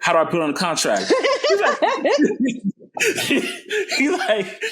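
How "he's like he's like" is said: high real laughter; laughing. Real, not acted. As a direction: crack up at the memory. Laughs retelling mentor.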